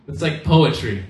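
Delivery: usual speaking voice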